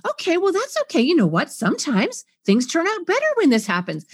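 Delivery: bright, high brush-off reassurance; cheerleader fix-it mode. Retold or imitated. Fix-it parent cheering up the child, too chipper. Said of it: "cheerleader fix-it mode".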